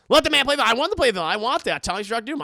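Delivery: loud, high excited demand; excited shout. Real, not acted. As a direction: demand it loudly.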